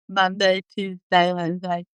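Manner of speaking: talking with tongue out and down; tongue sticking out. Shown as a demonstration. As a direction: say it with the tongue stuck out. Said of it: "have them talk with their tongue out and down".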